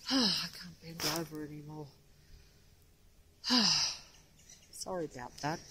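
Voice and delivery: straining while bent over; strained from bending. Real, not acted. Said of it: "I've got to bend over to read this plaque".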